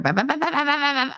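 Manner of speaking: high mock yapping on bah, bah, bah; hammed-up babble mocking a nagging grandma; mocking nag babble. Mocking grandmother's nagging with high babble.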